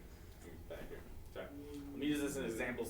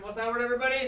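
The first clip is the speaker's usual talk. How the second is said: loud, high rallying question; raised teacher voice, pitched up high; calling out to the class; 10 semitones higher, 9 dB louder, faster than usual. Real, not acted. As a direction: rouse the whole room to answer. Rallying class to repeat loudly.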